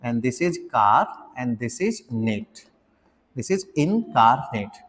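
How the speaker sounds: in, car, net said apart slowly; syllable split. Shown as a demonstration. Teacher spells out incarnate's pronunciation syllable by syllable. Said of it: "pronunciation लिख देता हूँ".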